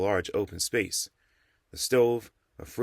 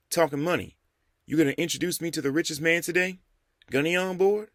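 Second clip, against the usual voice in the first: put-on higher, lively young man voice; eager young man; 9 semitones higher than usual. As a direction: play him keen and curious. Jamal's voice in the story.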